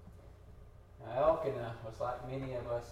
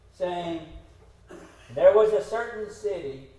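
loud, higher, emphatic parable reading; scripture reading; 4 semitones higher, 9 dB louder than usual. Acted. Preacher reads the parable on prayer to the congregation, loud and emphatic. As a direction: proclaim the verses to the room.

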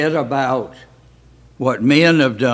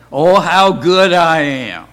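louder, slow, smug boast; mock bragging; 3 semitones higher, 7 dB louder than usual. Teacher mocking his own bragging, smug and hammed-up. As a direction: ham up a pompous self-congratulation. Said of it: "My bragging".

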